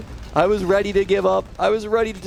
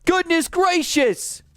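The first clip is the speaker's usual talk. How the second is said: shocked outcry; 11 semitones higher, much slower than usual. Real, not acted. Gamer crying out as the round jumps back. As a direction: yell it like the game just betrayed you.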